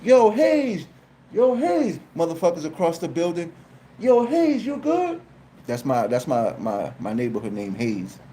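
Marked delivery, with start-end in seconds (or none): 0.0-2.0 calling out. Retold or imitated. Neighbors across the building calling to him, a high mock holler. 4.0-5.2 calling out. Retold or imitated. Neighbors across the building calling to him, a high mock holler.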